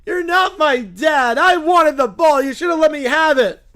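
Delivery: loud, high, slow furious yelling; angry yell. Acted. Outfielder yelling at the fan who stole his catch. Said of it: "slams his glove down and yells".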